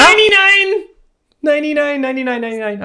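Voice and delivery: high, loud victory scream easing down. Real, not acted. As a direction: yell the number in triumph, then calm a little. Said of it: "I'm screaming so loud in this".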